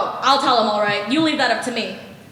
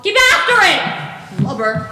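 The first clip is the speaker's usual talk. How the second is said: slow, loud, high bellow; angry shout after a slacker; yelled order; 5 semitones higher, 9 dB louder, slower than usual. Acted. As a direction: drive him on with a roar. Silver yells at shirking crewman.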